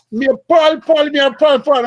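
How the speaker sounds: high, loud Jamaican mother calling out; imitating a Jamaican mom in full patois; mother coming out the bedroom, calling in full Jamaican accent. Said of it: "full dialect, whatever the full accent".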